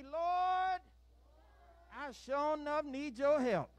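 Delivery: high, loud prayer line to repeat. Shown as a demonstration. Leader has children repeat a prayer, calling out firmly. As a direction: call it out for them to echo. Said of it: "We gotta say it with some".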